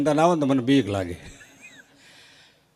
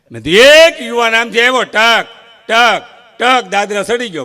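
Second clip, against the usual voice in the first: excited telling; 10 semitones higher, 10 dB louder than usual. Real, not acted. Excited telling of youth bounding up.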